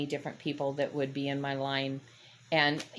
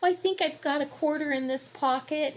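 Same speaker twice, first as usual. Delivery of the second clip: put-on little old lady; 11 semitones higher than usual. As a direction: play a flustered old lady counting coins. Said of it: "that little old lady".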